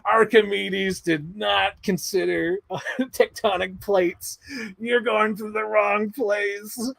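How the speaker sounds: high mock cackle, slow taunt; cackle, then draw out the gloating words; Harrison Ford. Imitating the hero mocking the villain's mistake, cackling taunt. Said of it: "like, cackling. He's like".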